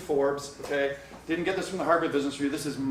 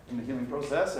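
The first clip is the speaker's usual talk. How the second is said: ordinary talk, nothing marked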